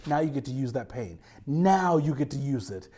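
a hint of God speaking low. God telling preacher to use his pain, low and measured. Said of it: "the day comes where God goes".

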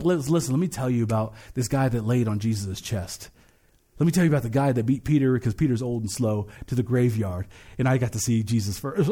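low, confiding storyteller; intimate John. Acted. Apostle John telling his own story, low intimate voice. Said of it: "that intimate aspect of John".

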